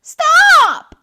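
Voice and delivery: very high, loud yell. A narrator's reading. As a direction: yell to stop her. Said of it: "I yelled".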